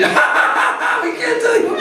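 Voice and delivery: put-on playful boys refusing to tell; teasing cowherd boys. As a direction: tease him, keep the secret.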